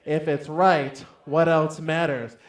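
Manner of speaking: loud, forceful rallying call; booming, emphatic declaration; ten times as loud. Shown as a demonstration. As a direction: belt it out for a crowd to repeat. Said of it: "ten times as loud".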